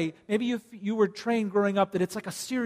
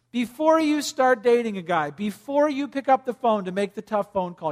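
ordinary talk, nothing marked

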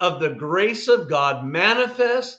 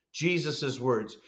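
ordinary talk

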